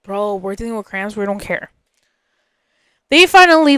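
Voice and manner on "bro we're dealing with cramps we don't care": low, quiet can't-be-bothered retelling; unbothered. Retold or imitated. Retelling friends' shared reaction to cramps, unbothered. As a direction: toss it off softly, too tired to care.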